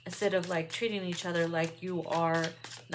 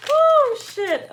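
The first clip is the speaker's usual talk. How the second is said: startled; 11 dB louder than usual. Real, not acted. Startled reaction.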